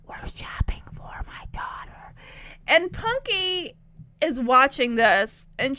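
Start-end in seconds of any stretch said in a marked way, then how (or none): 0.0-2.1 embarrassed whisper, tilted close. Retold or imitated. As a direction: hush it so no one hears.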